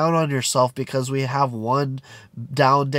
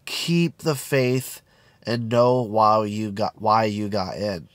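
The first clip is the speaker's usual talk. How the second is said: slow, lower, deliberate reassurance; slow and emphatic; 4 semitones lower, slower than usual. Shown as a demonstration. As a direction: spell it out slowly and low. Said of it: "tell people".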